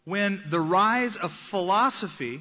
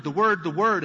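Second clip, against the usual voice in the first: "the word, the word" hammered and louder; mocking repetition; 6 dB louder than usual. Shown as a demonstration. Preacher mocking a one-sided emphasis, louder. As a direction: mimic a one-note chant of "the word". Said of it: "emphasis on just the word".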